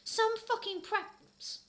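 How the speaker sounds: high drawn-out sneer; mock sneer. Reader sneering about the preps, slow high sneer.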